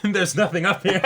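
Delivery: laughing through the words, pitch up; giggling at own blank mind. Real, not acted. Podcast host laughs admitting she has nothing to say.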